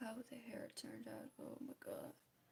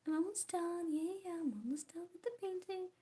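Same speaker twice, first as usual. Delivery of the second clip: high, sing-out imitation of a promise; mock reassurance; 7 semitones higher, 4 dB louder than usual. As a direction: pitch it up, playfully imitating your own promise. Imitating herself reassuring the viewers.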